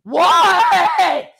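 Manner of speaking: high, loud bellow imitating a daughter; exaggerated screamed reply; mock angry kid's yell. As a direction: yell it back like an irritated child. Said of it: "she's like".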